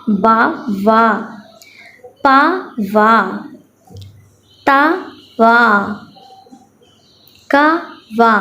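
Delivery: slow syllables with long pauses; teacher reading for children to echo; phonics reading drill. Shown as a demonstration. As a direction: read slowly so children repeat behind you. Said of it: "चलिए मैं पढ़ती हूँ".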